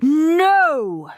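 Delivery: loud, high, long no; drawn-out cry to stop him; alarmed shout. A narrator's reading. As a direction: shout it out in horror. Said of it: "shouted Mum and Dad".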